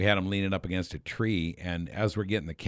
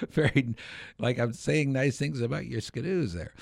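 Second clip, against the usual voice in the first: imitated puzzled actor, higher voice; baffled objection; 6 semitones higher than usual. Actor objecting to his script to the director, baffled imitation. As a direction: retell his protest with raised pitch.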